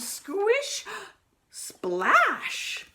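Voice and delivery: high, splashy squelch sounds; comic splash sound effects; squish and splash noises. Acted. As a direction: act out a wet splash. Pete steps in water.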